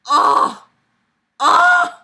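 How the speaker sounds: loud, high "oh" of horror; dismayed groan. Real, not acted. Dismayed cries at the note.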